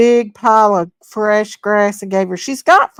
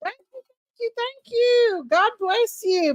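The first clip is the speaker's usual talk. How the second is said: much higher, softer cooing thanks; sing-song thanks; 13 semitones higher, 5 dB quieter than usual. Real, not acted. Thanking a viewer for five dollars, high cooing. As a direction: coo the thank-yous.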